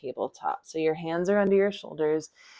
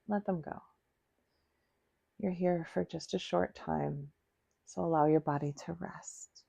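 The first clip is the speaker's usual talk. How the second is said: guided relaxation; 5 dB quieter than usual. Acted. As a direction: ease them down with long, unhurried breath cues.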